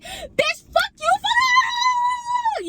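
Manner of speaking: very high, drawn-out mock scream; hammed-up shrieking meltdown; hella upset. Imitating an upset friend cursing the teller out, hella upset. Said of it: "She got hella upset".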